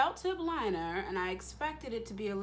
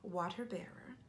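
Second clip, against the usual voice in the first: whispered "Water bearer"; hushed "Water bearer", said under the breath; 5 dB quieter than usual. Shown as a demonstration. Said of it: "that accent came from".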